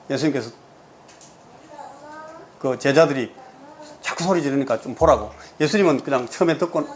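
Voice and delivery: normal talking voice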